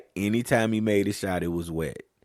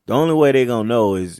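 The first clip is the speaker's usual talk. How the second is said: ordinary talk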